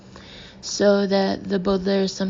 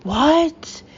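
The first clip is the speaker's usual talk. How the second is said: higher, louder startled roar; 8 semitones higher, 5 dB louder than usual. A narrator's reading. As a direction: bark it out in outrage. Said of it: "the captain roared".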